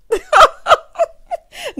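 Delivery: very loud, high laughing burst; mocking cackle. Real, not acted. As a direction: cackle in disbelief. Mocking the retold question.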